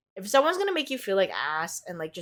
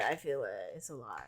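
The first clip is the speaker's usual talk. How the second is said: 1 sudden hush; 8 semitones lower, 11 dB quieter than usual. Real, not acted. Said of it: "overwhelmed by the reading".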